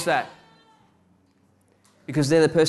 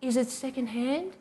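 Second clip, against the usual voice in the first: put-on small, high, soft child's question; high-pitched, quiet kid voice on a rising question; little voice; 9 semitones higher, 7 dB quieter than usual. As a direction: pipe up softly like a little kid. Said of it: "you hear a little voice say".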